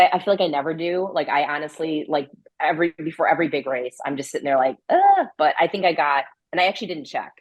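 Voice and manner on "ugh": a high, loud drawn-out groan of dread; exasperated groan. Retold or imitated. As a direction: groan like you can't face tonight. Dreading sleep before every big race.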